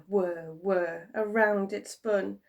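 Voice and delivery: usual speaking voice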